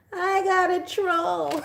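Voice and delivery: high, slow, delighted; slow gleeful gloat. Real, not acted. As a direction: savour it, high and drawn out. Gloating over troll.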